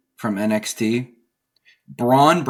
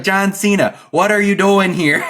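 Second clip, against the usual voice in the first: high, louder hammed-up Wisconsin accent; laughing through a Wisconsin impression; 11 semitones higher, 6 dB louder than usual. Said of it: "such a Wisconsin accent".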